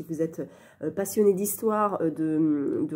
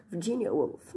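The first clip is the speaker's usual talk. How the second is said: slow, posh British "Virginia Woolf" with a laugh; English accent; 4 dB quieter than usual. Retold or imitated. Copying the author's British way of saying Virginia Woolf, laughing. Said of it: "ouh, c'est trop".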